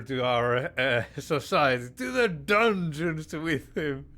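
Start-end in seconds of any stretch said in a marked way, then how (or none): 2.0-4.0 mock royal sentence. King sentences the blacksmith.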